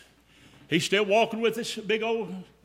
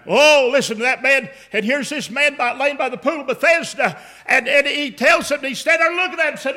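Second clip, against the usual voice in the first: loud, high, driving preacher's cadence; full-force preaching; 5 semitones higher, 6 dB louder than usual. Acted. Preacher to congregation, the healing story at full force. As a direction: preach it out, building the crowd.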